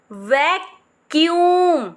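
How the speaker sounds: Vacuum very slow, weight on यूम; slow stressed ending. Shown as a demonstration. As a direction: drag it out, push यूम. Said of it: "यूम पे ज़्यादा मैंने pressure डाल के बोला है". (धीरे, यूम पर ज़ोर)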